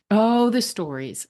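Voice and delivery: usual speaking voice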